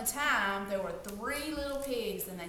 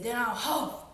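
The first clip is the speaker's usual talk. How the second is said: louder wolf building up; wolf warming up his threat, louder; 5 dB louder than usual. Acted. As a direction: huff and puff with a hint of menace. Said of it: "and he said".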